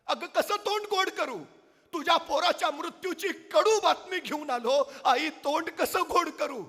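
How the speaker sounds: high, loud grief-stricken plea; king pleading, torn with sorrow; anguished king. Acted. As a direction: cry out the bad news to a grieving mother. Raje grieving to the mother.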